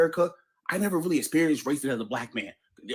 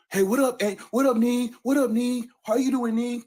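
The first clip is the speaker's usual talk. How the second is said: higher, louder street greeting; playful hey-what-up greeting among friends; friends' greeting; 9 semitones higher, 4 dB louder than usual. Retold or imitated. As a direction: call out a casual hey to a buddy. Friends greeting each other, retold.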